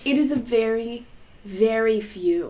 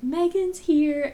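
mom's voice; 9 semitones higher, 4 dB louder, slower than usual. Retold or imitated. Mom on phone, quoted.

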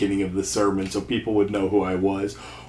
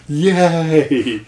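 crowd cheer; 6 semitones higher, 5 dB louder than usual. Retold or imitated. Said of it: "hear them cheer and go".

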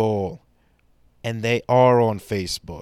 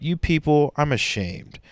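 normal talking voice

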